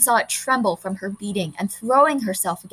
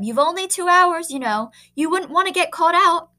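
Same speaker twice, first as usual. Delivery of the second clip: teacher calling; 8 semitones higher, 3 dB louder than usual. A narrator's reading. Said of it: "called the teacher".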